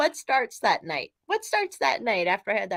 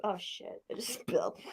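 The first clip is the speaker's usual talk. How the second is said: muttered; 3 semitones lower, 14 dB quieter than usual. Real, not acted. Spilling a drink.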